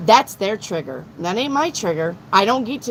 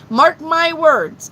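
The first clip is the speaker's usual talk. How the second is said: emphatic declaration; 7 semitones higher, 6 dB louder, slower than usual. Real, not acted. Hyping up her bingo plan.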